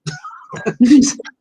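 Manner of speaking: high burst of delighted laughter; excited laugh. Real, not acted. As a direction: laugh out loud in disbelief. Stunned at meeting famous director.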